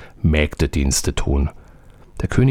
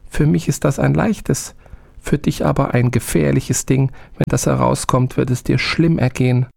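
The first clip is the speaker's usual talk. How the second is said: higher little-spirit voice, warning; 9 semitones higher than usual. A narrator's reading. Little man warns the soldier, higher. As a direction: caution the master like a small wary servant. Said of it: "Das Männchen sprach".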